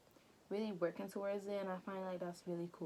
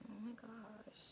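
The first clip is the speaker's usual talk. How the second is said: whispered aside; 3 semitones higher, 11 dB quieter, slower than usual. Real, not acted. Embarrassed aside while watching.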